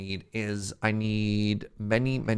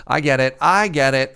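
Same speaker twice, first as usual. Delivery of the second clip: excited realization; 4 semitones higher, 12 dB louder than usual. Real, not acted. Finally gets the joke.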